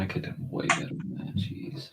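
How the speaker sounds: whispered, dismayed at own drawing; whispered self-critique. Retold or imitated. Artist seeing flaws the next day, whispered dismay. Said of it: "it's like".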